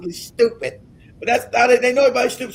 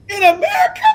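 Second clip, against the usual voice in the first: high-pitched cry of disbelief; incredulous; 10 semitones higher than usual. Acted. Speaker mocking the idea of it in America, incredulous. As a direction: squeal it as an absurd question. Mock disbelief amid laughter.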